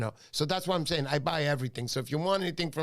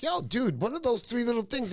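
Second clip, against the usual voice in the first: excited retold question; 8 semitones higher than usual. Retold phone call to artist.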